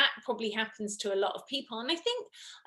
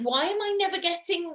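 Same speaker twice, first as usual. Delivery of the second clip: exasperated question; 7 semitones higher, faster than usual. Retold or imitated. Complaining to herself, retold.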